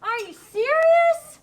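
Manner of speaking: loud, high, slow outrage; mad exclamation. Shown as a demonstration. Teacher models exclamation point, mad outburst. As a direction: exclaim it angrily, stretching "serious". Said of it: "So he's mad".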